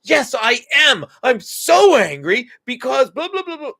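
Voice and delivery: put-on high, loud, slow kid venting; angry child. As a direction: play a kid pouring out anger. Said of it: "They're like".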